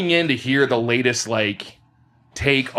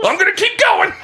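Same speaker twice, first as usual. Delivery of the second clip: mock determined yell; 5 dB louder than usual. Voicing himself, mock determined.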